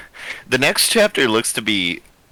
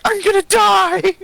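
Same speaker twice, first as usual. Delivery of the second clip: much higher, far-off hammed-up panic; panicked victim; 7 dB louder than usual. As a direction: squeal like a doomed game character. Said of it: "just goes like".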